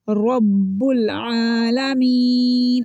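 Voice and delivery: रब्बुल with thick rounded r, "robbul"; slow chant of रब्बुल आलमीन, heavy first letter; heavy ra recitation. Shown as a demonstration. Teacher showing ra with zabar, thick and drawn out. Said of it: "मोटा करके पढ़ेंगे".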